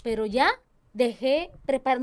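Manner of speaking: ordinary talk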